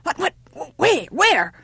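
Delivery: high, stammering alarm; alarmed cry. A narrator's reading. Old woman expects a wolf at the least, alarmed. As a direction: blurt it out in a panic, stumbling. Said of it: "cried the old woman, expecting a wolf".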